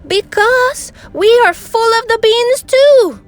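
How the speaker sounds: high, loud exclamation; alarmed explanation. A narrator's reading. Doggie explaining why the bandit comes, high alarmed cry. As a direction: blurt it out in mock alarm. Alarmed answer to hero's question.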